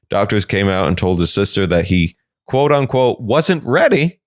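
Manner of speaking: normal talking voice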